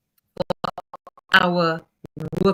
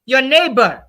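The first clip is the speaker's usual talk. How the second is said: impassioned plea; 4 semitones higher, 5 dB louder than usual. Real, not acted. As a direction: plead loudly with the audience.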